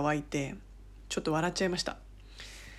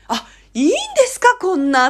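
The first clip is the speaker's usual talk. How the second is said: higher, louder grateful gasp of words; delighted surprise; 9 semitones higher, 6 dB louder than usual. Retold or imitated. Guest receiving a gift, gushingly surprised. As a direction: react as if overwhelmed by a gift.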